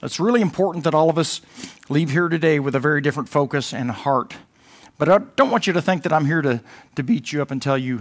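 rising pulpit fervor; full-force preaching. Acted. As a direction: preach it to the back row.

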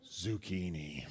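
soft horrified gasp, slow; shocked, slow, breathy reveal; gasping realization. Retold or imitated. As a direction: gasp out the word in dawning horror. Family realizes the trick.

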